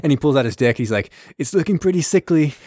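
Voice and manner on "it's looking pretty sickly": high, strained mock-sickly voice; slow down into a high, feeble plea; sick Russian captain. Sick Russian checks himself before battle, high and feeble. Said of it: "the sick Russian's like".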